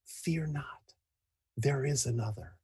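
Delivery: dying breath whisper. Acted. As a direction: breathe it out, barely voiced. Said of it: "and whispers".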